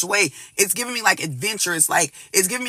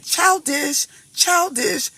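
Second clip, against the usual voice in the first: higher, mocking sing-song on Childish; 10 semitones higher, much slower than usual. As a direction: tease the word Childish up high like a taunt. Mocking the fear of rides.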